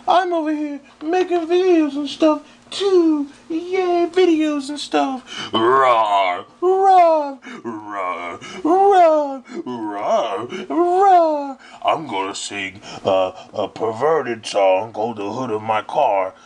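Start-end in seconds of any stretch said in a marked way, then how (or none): 0.1-5.5 put-on much higher, bubbly valley girl voice; girly voice. Stephanie voice again. 5.5-11.6 playful rawrs. Acted. Alternating low and high rawrs.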